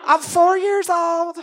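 put-on high, slow little pageant girl; small Missouri girl, sweetly entitled; Bitsy voice. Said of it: "But she goes".

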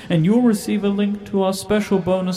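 upbeat promo announcer; ad read. Acted. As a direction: sell it like a trailer voiceover. Trailer ad read.

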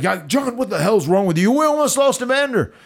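high retold scolding. Boss scolding John over nearly losing Evander, rising high imitation. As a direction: imitate the boss's alarm, climbing in pitch. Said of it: "definitely V K M".

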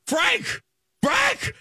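very high, squealed mock scream; panicked scream. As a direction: yelp it in a retold panic. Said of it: "I'm screaming Frank as loud as I".

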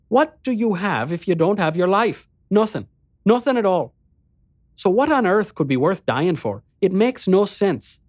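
high, insistent, sweetly reasoning voice; persuasive Hamarta. Acted. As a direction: argue that nothing is worth dying for.

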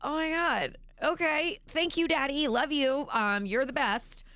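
high, bright-eyed mock thanks; forced gratitude. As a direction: gush thanks while hiding dismay. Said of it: "And I'm like".

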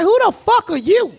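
high indignant challenge; mock furious comeback; retold outrage. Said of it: "I'm like".

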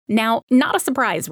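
ordinary talk, nothing marked

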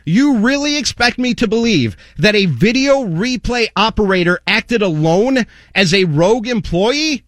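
ordinary talk, nothing marked

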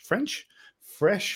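slurring words with loose teeth; fumbling words through loose dentures. Real, not acted. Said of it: "I'm gonna put my teeth back in".

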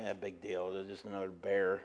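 low, soft, unimpressed shrug; mock-bored brush-off; bored local. Tennessee locals shrugging off a bear, low and unimpressed. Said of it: "They're like".

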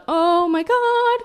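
high, drawn-out mortified moan. Retold or imitated. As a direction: stretch it out in embarrassment. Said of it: "I'm like".

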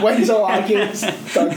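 laughing. Real, not acted. Laughing triumph over Terminator quote.

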